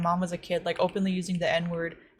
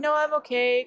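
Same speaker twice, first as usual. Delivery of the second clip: retold shy refusal, pitched up; 7 semitones higher than usual. Retelling a fan turning down a photo, shy. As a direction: act out a bashful little refusal. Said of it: "I was, like, really shy".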